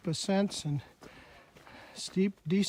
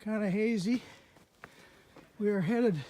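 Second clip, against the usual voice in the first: ordinary talk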